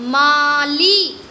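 माली with long आ and ई drawn out; teacher reading माली aloud to the class; stretched reading. Shown as a demonstration. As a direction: say माली slowly, both vowels long. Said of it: "ऐसे ही पढ़ते हैं".

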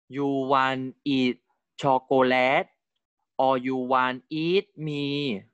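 slow, drawn-out read of the passage. Shown as a demonstration. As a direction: read each word slowly and carefully for learners. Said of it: "I will read this for you slowly".

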